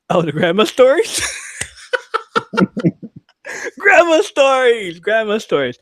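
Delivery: loud, high gleeful cry; laughing, thrilled exclamation; excited laughing. Real, not acted. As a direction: shout it with delight. Excited laughing grandma stories.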